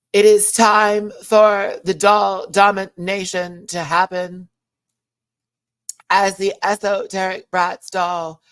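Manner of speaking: over-the-top drag queen proclamation; Jade impression. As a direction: deliver it like a dramatic drag queen speech. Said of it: "your impression of Jade is really on point".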